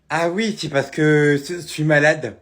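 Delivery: loud, slow lisp; lisped excuse, drawn out and loud. Acted. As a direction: force the words out past a sore tongue piercing. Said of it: "Si, tu zozotes".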